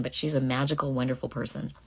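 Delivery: ordinary delivery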